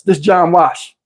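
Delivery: normal talking voice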